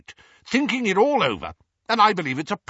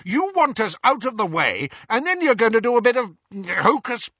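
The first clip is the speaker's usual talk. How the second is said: loud, high furious rant; ranting uncle; 12 semitones higher, 5 dB louder than usual. A narrator's reading. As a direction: rant faster as it builds. Vernon ranting about plot.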